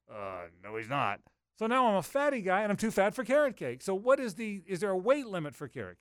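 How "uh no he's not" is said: imitating Lauren. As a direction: drop low to mimic a curt contradiction. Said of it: "And Lauren goes".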